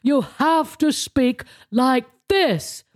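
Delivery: high-pitched start, slowing into a measured lecture; put-on stateswoman voice, instructing; Margaret Thatcher impression. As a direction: imitate Thatcher, slow and emphatic at the end. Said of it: "my Margaret Thatcher impression".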